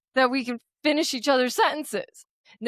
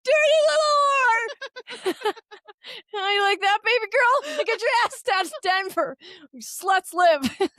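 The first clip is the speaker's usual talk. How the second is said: camp it up as a leering cowboy; hammed-up insult; 12 semitones higher than usual. Cowboy heckling the girls at the door, hammed-up high sneer.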